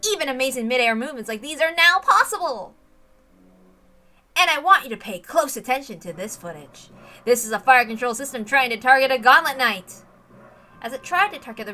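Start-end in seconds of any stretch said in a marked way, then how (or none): 0.1-10.0 louder promo voiceover, building hype; trailer announcer. Acted. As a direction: pitch the footage like a sales reel. Promo video voiceover returns.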